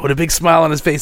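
ordinary talk

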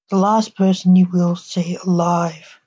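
plain reading voice

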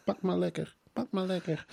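high, soft cooing voice; mock doting publisher staff. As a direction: coo sweetly while pushing cake on the guest. Plays publisher staff offering cake.

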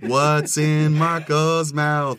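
slow, high game show announce; drawn-out, high segment title call; announcer voice. Pretend. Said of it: "announcing the segment title".

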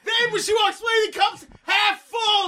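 loud, high, laughing disbelief; incredulous shouting. Real, not acted. Incredulous retelling.

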